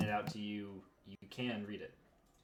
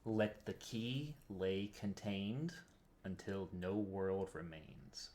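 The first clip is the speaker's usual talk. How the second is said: slow deliberate reading; much slower than usual. Shown as a demonstration. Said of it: "One more time?".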